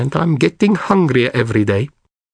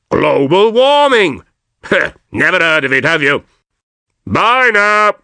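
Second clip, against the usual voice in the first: cheeky walrus voice; 5 semitones higher, 3 dB louder than usual. Acted. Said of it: "this extremely cheeky walrus".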